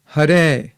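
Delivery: Hare with a light tapped R, clear and slow; teaching pronunciation. Shown as a demonstration. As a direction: say Hare for the class to repeat. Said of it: "I will say the mantra and you pronounce it after me".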